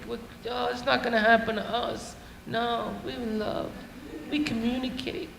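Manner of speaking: very soft, mock-dreamy lovers; naive couple. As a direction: imitate starry-eyed couples in a hushed voice. Naive single couples, imitated.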